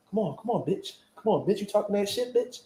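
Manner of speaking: soft, muttered goading; mock taunt. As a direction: imitate a fighter taunting under her breath. Imitates her taunting before the hit.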